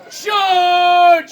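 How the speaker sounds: loud, long-held shout; battle cry. Acted. Antony on horseback leading the attack, battle cry. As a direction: sound the attack, stretching the word. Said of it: "he gets on his horse and he cries".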